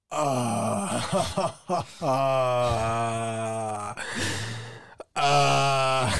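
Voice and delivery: long, drawn-out groan of dread; audible groan. Retold or imitated. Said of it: "like, an audible groan".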